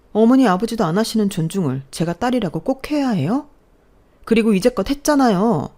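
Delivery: loud defiant comeback; angry retort. Acted. Daughter defying her parents about respect, angry retort. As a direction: throw their words back at them.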